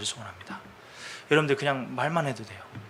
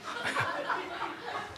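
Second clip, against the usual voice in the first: a short airy silent laugh; voiceless chuckle; 10 dB quieter than usual. Real, not acted. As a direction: laugh under the breath at yourself. Laughing at own doubts. (자기 의심에 소리 없이 피식 웃음)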